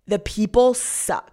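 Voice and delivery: slow, drawn-out "the people suck" with weight on "people"; slow emphatic complaint. Real, not acted. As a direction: lean hard on "people" and drag the line out. Complaining about the Bay Area.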